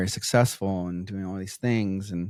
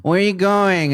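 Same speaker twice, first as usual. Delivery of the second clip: put-on higher, louder pestering child; nagging kid; 10 semitones higher, 5 dB louder than usual. Kid pestering mom on her way out, high whine. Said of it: "we'd be like".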